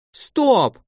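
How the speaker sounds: high, sharp cry without much volume; acted shout of stop; shouted halt. A narrator's reading. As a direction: cut them all off with a high yell. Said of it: "крикнула на этот раз Аркадия".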